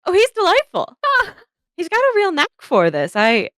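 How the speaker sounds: very high, gushing praise; Charlotte's voice. Acted. Painter praising the young lord, with high cooing delight. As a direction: coo over the boy in a high voice. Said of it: "and she goes".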